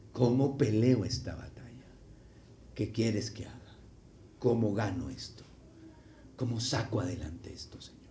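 softly acted submissive prayer; hushed, low plea of a servant; humble prayer. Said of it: "usted necesita postrarse y decir".

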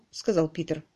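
plain reading voice

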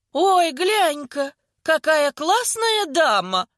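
slow, drawn-out high mock admiration. A narrator's reading. Older woman ridiculing Vika's fussiness, mocking. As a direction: stretch it out to tease her.

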